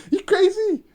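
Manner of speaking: you can't be serious; incredulous laugh. Real, not acted. Vlogger laughs at the murderer's excuse in disbelief.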